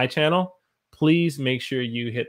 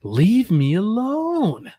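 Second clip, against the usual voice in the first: high, slow, drawn-out whine; whiny high plea; 9 semitones higher, 5 dB louder, much slower than usual. Shown as a demonstration. Teacher acting out the phrase again, high whine. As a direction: stretch it like a sulky kid.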